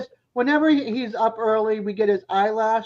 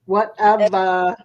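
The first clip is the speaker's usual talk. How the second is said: Boston accent; 4 dB louder, much slower than usual. Shown as a demonstration. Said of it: "my Boston accent that rubbed off on him".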